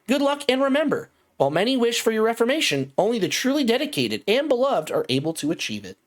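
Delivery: high-pitched, singsong automated voice; synthetic, measured announcement pitched well up; robotic announcer. Acted. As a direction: recite it like a cheery corporate computer. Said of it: "you can hear that same robotic voice".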